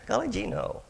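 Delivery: high, lightly goofy replay of his own answer; goofy gee-whiz voice. Retold or imitated. Retelling his reply to the cook, lightly goofy high voice. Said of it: "you sound like Elmer Fudd".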